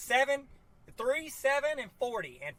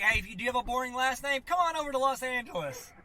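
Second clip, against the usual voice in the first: hammed-up ad pitch, higher, jersey line louder; mock commercial pitch; 5 semitones higher, 6 dB louder than usual. Joking that LA recruits boring names, mock ad voice. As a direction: sell it like a cheesy TV ad.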